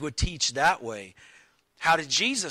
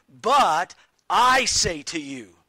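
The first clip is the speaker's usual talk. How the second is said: "I" slow and louder, firm; stress on I; 6 dB louder than usual. Retold or imitated. Preacher voicing Jesus' authority to the listeners, stressed. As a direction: lean on "I" with authority. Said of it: "He's speaking by his own authority".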